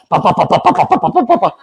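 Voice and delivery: rapid string of popping syllables; imitated chopping noises. King's men cut down rebels, mimicked chopping sounds. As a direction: mimic blow after blow. Imitating the beheadings, one after another.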